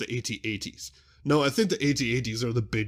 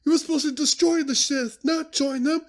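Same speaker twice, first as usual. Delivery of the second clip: much higher, louder anguished voice; hammed-up heartbroken cry, pitched way up; imitating Obi-Wan; 6 dB louder than usual. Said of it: "Obi-Wan's like".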